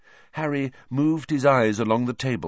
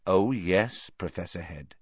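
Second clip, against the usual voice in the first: oily blackmailer purr; 3 semitones lower than usual. A narrator's reading. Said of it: "he adopted an oily voice".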